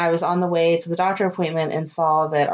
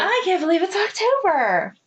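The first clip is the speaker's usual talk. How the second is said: hammed-up disbelief, much higher; playful mock disbelief; 11 semitones higher than usual. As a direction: toss the line back in a playful mock voice. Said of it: "you didn't say it".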